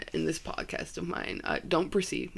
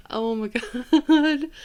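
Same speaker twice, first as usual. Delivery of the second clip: oh my God, high and loud through laughter; laughing; 8 semitones higher, 9 dB louder than usual. Real, not acted. Podcaster still laughing at her own risque joke, laughing. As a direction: let the laugh take over the words.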